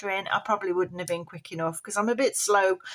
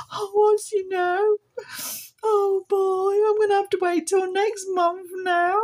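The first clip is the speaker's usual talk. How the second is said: sad puppet voice; 10 semitones higher, 3 dB louder than usual. Pretend. Puppet Polly still sad.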